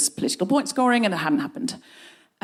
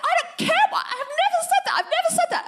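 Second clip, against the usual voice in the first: extremely high, squealed denial; high-pitched outrage; much faster than usual. Retold or imitated. Comedian denying she ever tweeted it, high-pitched outrage. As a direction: cut them off with frantic, squeaky protest. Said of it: "I got a bit high-pitched".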